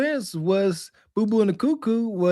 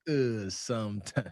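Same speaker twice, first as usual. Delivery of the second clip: much lower, quiet, far-off man's drawn reply; Brian's voice; 11 semitones lower, 12 dB quieter than usual. Retold or imitated. Brian admits on the phone that they call him Bree, low and sheepish. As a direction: sink into a low sheepish guy.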